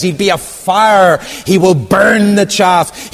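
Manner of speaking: high, loud and slow warning; fiery prophet. Acted. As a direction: blaze it out to the crowd. John the Baptist proclaiming.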